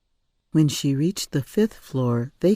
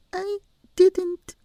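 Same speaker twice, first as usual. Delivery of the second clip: put-on high child's voice; simple small answer; little girl voice. A narrator's reading.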